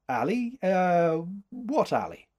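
high, hesitant, drawn-out "Uh"; feigned ignorance. A narrator's reading. Man caught out about following her, evasive.

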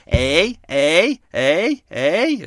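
high, loud nasal honks; Canada goose honk. Retold or imitated. Vlogger imitating Canada geese flying over, honking. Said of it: "that was my Canadian impression".

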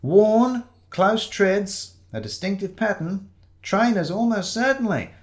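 high-pitched detective listing clues; musing sleuth. Acted. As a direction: deduce aloud in a high voice. Shiloh examines shoe prints under table.

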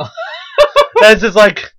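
high, slow, laughing through the words. Real, not acted. Teller laughing while recalling the customer. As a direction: giggle it out helplessly.

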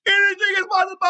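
sudden high, fast triumphant scream; KG yell. Retold or imitated. Podcaster opening his show with a KG championship scream. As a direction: roar it like a champion who just won it all. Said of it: "I had to do the K G".

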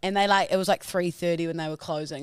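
ordinary delivery

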